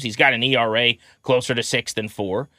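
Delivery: usual speaking voice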